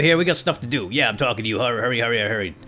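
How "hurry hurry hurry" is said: usual speaking voice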